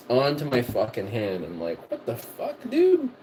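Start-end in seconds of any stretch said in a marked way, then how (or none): 1.9-3.1 high disbelieving squawk; shocked what the fuck. Retold or imitated. Shocked at grease on hand.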